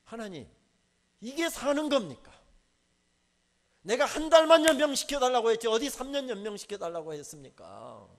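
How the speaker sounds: shouting a furious protest at God; angry protest. Retold or imitated. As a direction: demand an answer from God. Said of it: "뭐라고 따졌게요".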